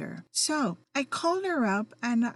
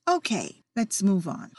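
ordinary talk, nothing marked

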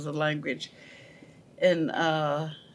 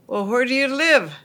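farmer calling out; 6 semitones higher, 5 dB louder than usual. Retold or imitated. Farmer asks the girls.

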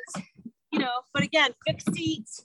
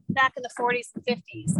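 ordinary talk, nothing marked

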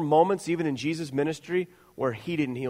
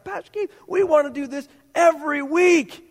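put-on excited kids; 11 semitones higher, 5 dB louder than usual. Kids returning excited, quoted.

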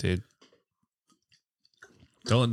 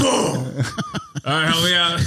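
laughing; 7 semitones higher than usual. Acted. Turtle on his back.

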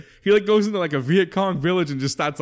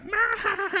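very high mock monster wail; imitated monster cry; 3 dB quieter than usual. Mimicking Large Marge's transformation, high monster wail.

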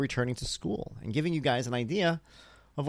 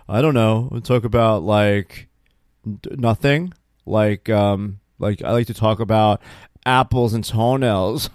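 loud, dawdling mimicked kid; loud retold silly answer, slowing at the end; goofy daughter; 7 dB louder, slower than usual. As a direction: imitate a kid stalling with nonsense.

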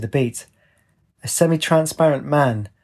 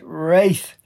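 higher, drawn-out narrated call; mustered shout; 4 semitones higher than usual. A narrator's reading. Searching the campfire for Wraith, a mustered shout. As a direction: muster a mild shout, not full volume. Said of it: "Mustering a shout, she cried".

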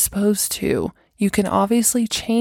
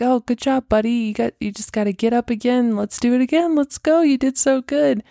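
put-on higher, upbeat cheering on a little kid; cheery encouraging parent; 5 semitones higher, faster than usual. Parent laughing off the child's fall, cheerful encouragement. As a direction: pump the kid up like a proud parent.

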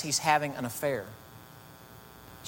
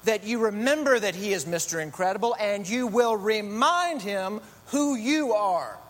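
Edna Mode; 6 semitones higher than usual. Retold or imitated. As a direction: lecture her like a fierce little couturier. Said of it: "She says".

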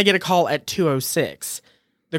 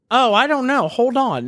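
clueless rep; 8 semitones higher than usual. Retold or imitated.

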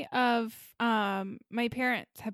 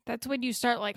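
ordinary delivery

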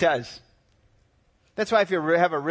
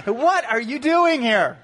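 higher and louder, exasperated outburst with a laugh; outraged prophet questioning God, higher and louder; mock indignant Habakkuk; 6 semitones higher, 3 dB louder than usual. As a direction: protest to God in hammed-up disbelief. Habakkuk protesting God's plan.

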